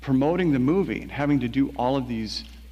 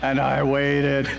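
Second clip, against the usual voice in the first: "waited" stretched out slowly and louder; drawn-out waiting; 4 dB louder, much slower than usual. Real, not acted. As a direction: draw out "And I waited" to milk the wait. Comic repeat of a long wait.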